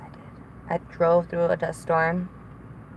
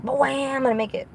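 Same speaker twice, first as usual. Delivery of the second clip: mock triumphant dad, higher pitched; lightly voiced dad cheering himself on; imitating dad; 7 semitones higher than usual. Daughter imitating her dad racing the light, mock triumphant. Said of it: "my dad will always be like".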